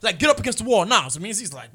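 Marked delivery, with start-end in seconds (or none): 0.2-0.8 bossy cop. Retold or imitated. Acted cop ordering them.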